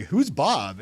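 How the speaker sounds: loud, high-pitched puzzled call; retold yell. Imitating confused colleagues asking about the email, calling out. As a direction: call out the question, mimicking confused coworkers. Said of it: "somebody yelled over".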